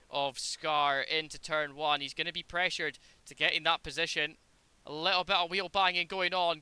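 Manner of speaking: loud, lively race call; motorsport commentator. Acted. Sim race play-by-play.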